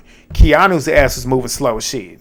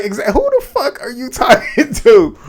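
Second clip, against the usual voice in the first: laughing retort; 9 semitones higher than usual. Retold or imitated. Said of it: "I was like".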